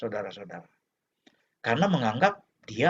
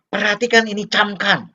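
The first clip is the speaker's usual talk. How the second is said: loud, raised commanding voice; stern parent; 6 semitones higher, 5 dB louder than usual. Acted. Parent ordering child to pay attention, stern. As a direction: order the child to take heed, higher and louder. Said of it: "atau dia ngomong".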